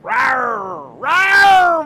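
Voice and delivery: loud, high roaring on request; tiger roar. Pretend. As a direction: roar like a big cat, full force. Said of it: "Vince wants you to roar like a tiger".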